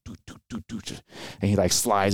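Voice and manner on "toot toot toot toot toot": soft unvoiced toots, like quick running steps; whispered footstep sounds. Soldier running over to hush him, whispered footsteps. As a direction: tap out hurried footsteps under your breath.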